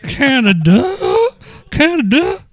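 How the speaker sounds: very high, shouted twang on "Canada", rising; country Canada. Shown as a demonstration. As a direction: yelp "Canada" like a rodeo holler. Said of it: "how would you say Canada in a country way?".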